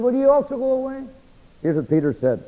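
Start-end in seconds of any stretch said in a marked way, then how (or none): 0.0-1.0 pitched well up, searching; higher pleading question. Retold or imitated. Jesus asking the disciples if they'll leave, higher and pleading. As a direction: lift the voice high, as if hurt.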